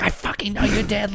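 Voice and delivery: lightly voiced fed-up teacher; a hint of the teacher snapping at the girl; mock angry teacher. Teacher snaps back at girl.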